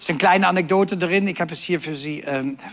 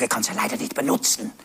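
whispered fast, embarrassed; whispered complaint; 4 semitones lower, faster than usual. Retold or imitated. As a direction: hush it so the customers can't hear. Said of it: "Ich kann nicht hier so laut reden". (verschämt geflüstert, schnell)

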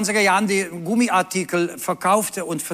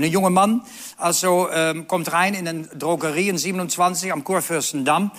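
usual speaking voice

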